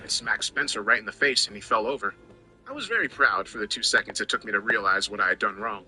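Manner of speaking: ordinary delivery, nothing marked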